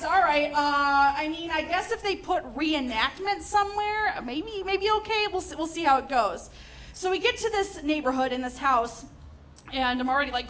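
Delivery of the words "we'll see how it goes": normal talking voice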